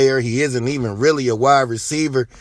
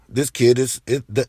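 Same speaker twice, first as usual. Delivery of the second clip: ordinary delivery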